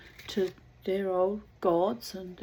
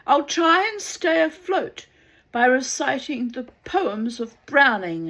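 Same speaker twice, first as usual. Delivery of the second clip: calling out; 6 semitones higher, 9 dB louder than usual. Acted. Drowning man.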